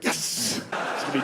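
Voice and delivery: a tiny breathless whispered yes. Acted. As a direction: cheer under your breath, unvoiced. Acted inner cheer of boyfriend.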